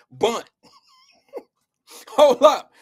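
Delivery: breaking up with laughter, loud on the last words; laughing. Real, not acted. Streamer cracking up mid-story about his wife, laughing. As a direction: try to talk through a laughing fit. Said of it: "don't make me laugh".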